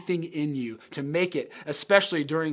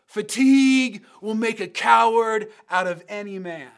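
loud, raised sideline yell; coach's yell; 5 semitones higher, 8 dB louder than usual. Retold or imitated. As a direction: call it out like a coach driving exhausted runners. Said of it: "he would yell this phrase at us".

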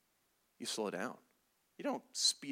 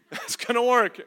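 louder, higher, laughing disbelief; laughing sarcasm; 7 semitones higher, 10 dB louder than usual. Shown as a demonstration. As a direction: scoff through a laugh.

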